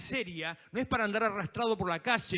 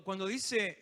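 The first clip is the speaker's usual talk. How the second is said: ordinary talk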